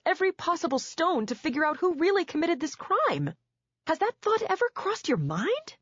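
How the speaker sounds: high, fast, heated demand; she's furious and pressing him hard; accusing questions pouring out fast and high; angry outburst. Acted. Wife confronting husband, rising pitch.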